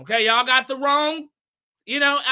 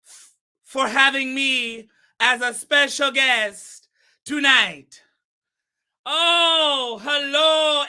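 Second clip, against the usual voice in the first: put-on Shaba accent; 4 dB louder than usual. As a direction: greet the audience grandly in Shaba's accent. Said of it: "keep this accent all night".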